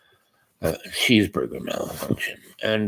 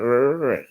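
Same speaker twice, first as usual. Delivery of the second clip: short growl, pitched higher; grumbling growl; 4 semitones higher than usual. Retold or imitated. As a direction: growl your displeasure at the dentist. Reacting to more dental work.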